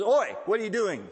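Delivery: loud, high challenge on a sharp oi; Aussie-style oi. Retold or imitated.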